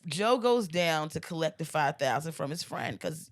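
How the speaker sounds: ordinary talk, nothing marked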